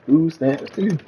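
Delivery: words through a laugh; cracking up while saying it. Real, not acted. Laughing at own joke.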